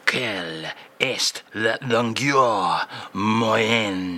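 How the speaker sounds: put-on slow, low French-accented crooner voice; a slow French charmer's voice. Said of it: "as read out eloquently by [name]".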